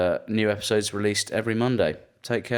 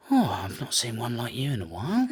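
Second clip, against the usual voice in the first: lightly put-on voice of an admiring stranger; a hint of comic accent, on the edge of laughing; imitated admiring stranger; 3 semitones higher than usual. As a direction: do a nosy pub-goer's accent, amused. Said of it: "I wasn't gonna do the accent".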